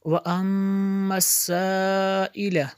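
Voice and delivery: वअम्मस with a long hummed m, साइला with a long aa; ghunna and madd. Shown as a demonstration. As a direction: hold the m of वअम्मस, stretch साइला. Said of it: "मीम शद्दत गुन्ना होगा". (वअम्मस में गुन्ना, साइला में लंबा मद)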